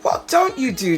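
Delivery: ordinary talk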